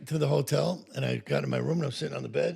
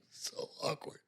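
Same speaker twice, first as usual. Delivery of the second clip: whispered aside; 11 dB quieter, slower than usual. Real, not acted. Whispered comment on awkwardness.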